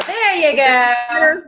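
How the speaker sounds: normal talking voice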